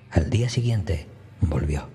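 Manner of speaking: plain reading voice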